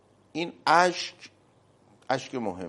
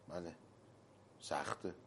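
ordinary talk

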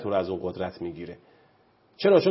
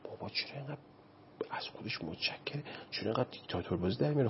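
hushed, secretive whisper, quickening at the end; whispering behind his back; 2 semitones higher, 12 dB quieter, faster than usual. Retold or imitated. Kids griping behind dad's back, in a hushed whisper. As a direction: whisper it so dad can't hear. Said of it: "پشت سر بابا میگن".

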